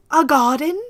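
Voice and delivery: gasping, delighted question; gasp, then ask in wonder; breathless little gasp; gasp of surprise. Real, not acted. Gasps at the garden.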